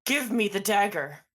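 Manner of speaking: loud, firm order; urgent demand. Acted. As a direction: demand it forcefully. Character urgently demands the dagger.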